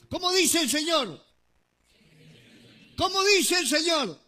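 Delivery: loud, preaching call to the congregation. Real, not acted. Preacher demanding an answer from the congregation, loud. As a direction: throw the question out to the crowd.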